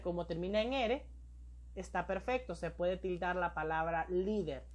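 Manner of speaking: ordinary delivery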